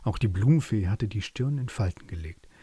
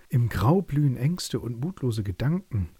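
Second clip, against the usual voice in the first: plain reading voice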